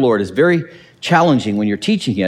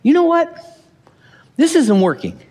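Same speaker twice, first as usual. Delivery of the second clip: realization; 12 semitones higher than usual. Retold or imitated. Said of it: "prudent enough to say".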